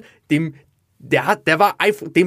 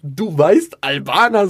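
raised, questioning echo of his own words; incredulous self-mockery; 3 semitones higher, 4 dB louder, slower than usual. Comedian mocks what he told the rapper, incredulous. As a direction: throw it back in disbelief. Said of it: "was, was laber ich da".

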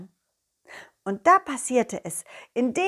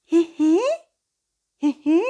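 high questioning giggle; 5 semitones higher, 4 dB louder than usual. Acted. Ball character questioning giggle.

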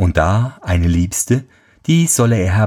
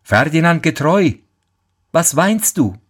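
higher, calling from behind; lightly raised call; calling again; 8 semitones higher than usual. Acted. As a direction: call out to him, unseen. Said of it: "Da ruft es wieder".

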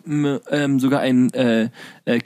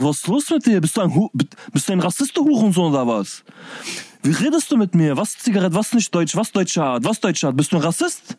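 high, indignant outburst; mock outrage at the teacher; angry retort; 5 semitones higher than usual. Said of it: "ich sag". (empört, deutlich höher, als Rückfrage an den Lehrer)

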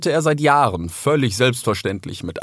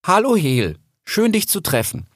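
higher, composed, deliberate greeting; firm tone; 10 semitones higher than usual. A narrator's reading. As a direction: greet with gathered confidence. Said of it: "sagte dann in bestimmtem Ton". (in bestimmtem Ton)

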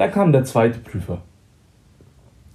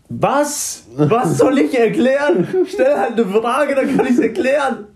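much higher, laughing, slower; exasperated self; 10 semitones higher, slower than usual. Retold or imitated. Retelling baffled protest to the examiner, high laughing voice. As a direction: replay your own baffled protest with a laugh. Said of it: "Und ich so".

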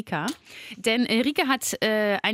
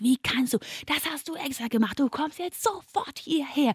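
angry father; 5 semitones higher, 3 dB quieter than usual. Retold or imitated.

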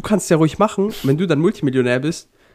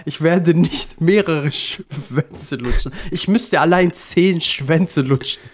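langsam betont; slower than usual. Real, not acted. Insisting slowly.

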